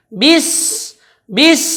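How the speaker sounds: bis said with flowing s; correct bis. Shown as a demonstration. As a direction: bis with s breath. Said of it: "Maka bacaannya bis". (bis dengan sin berdesis)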